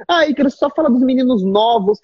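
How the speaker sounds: put-on protesting student; objecting viewer.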